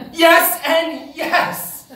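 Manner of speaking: overjoyed answer; ecstatic yes. Real, not acted. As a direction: savor each yes with glee. Eager reply to rematch.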